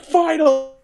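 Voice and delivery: high, loud, stretched-out cry; mock triumphant cry. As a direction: belt it out in mock relief. Mock exclamation about getting subtitles.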